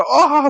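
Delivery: high-pitched exclamation of distress; hammed-up yelp under verbal attack; mock anguished cry. Girl battered by blunt facts, mock anguished cry. 여자애가 팩트 공격에 비명.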